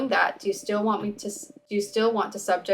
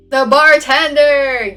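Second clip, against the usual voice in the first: slow, much louder announcing cry; dramatic card reveal; 6 semitones higher, 13 dB louder, much slower than usual. Acted. Witch announcing the drawn tarot card, loud slow proclamation. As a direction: proclaim the card name slowly and loudly, higher.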